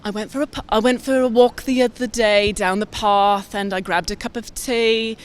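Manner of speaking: a light posh British accent, English vowels. Shown as a demonstration. Rugby player tries a posh British accent for the interviewer. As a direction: plays at an upper-crust English lady, lightly. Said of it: "I'll try posh".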